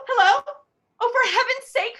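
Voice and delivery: a weepy plea into the phone; near tears. Acted. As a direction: let the voice crack with upset. Upset caller close to tears.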